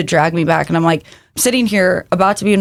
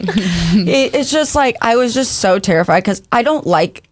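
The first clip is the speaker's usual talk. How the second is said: ordinary delivery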